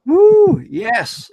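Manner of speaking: loud, high whoop of joy; excited cheer. Real, not acted. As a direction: whoop it up for the crowd. Guest cheers his introduction.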